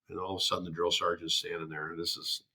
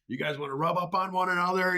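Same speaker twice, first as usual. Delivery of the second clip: mocking drill sergeant; 9 semitones higher than usual. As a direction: taunt them like a drill sergeant.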